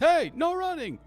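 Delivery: high, loud reprimand; shouted order to stop; screaming guard. Acted. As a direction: yell across a lobby like security. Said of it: "screaming at you from the desk".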